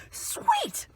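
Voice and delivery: one word squeaked very high; delighted squeal. Retold or imitated. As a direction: squeak it out with glee. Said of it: "I was like".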